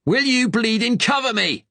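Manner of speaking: very high, loud Cockney yell; ringing Cockney shout. A narrator's reading. Gunman shouting at the stunned German to cover him, ringing Cockney yell. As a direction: shout it so it rings across the beach. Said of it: "his voice ringing across the beach".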